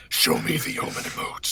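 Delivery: unvoiced whispered command; Omen voice. Asking chat for emotes in character, whispered mock menace. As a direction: demand it in a shadowy whisper.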